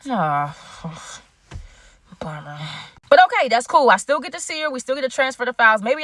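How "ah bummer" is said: soft sighing letdown, voice sinking away; disappointed sigh. Retold or imitated. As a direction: let the air out, disappointed. Disappointed by her cold reply.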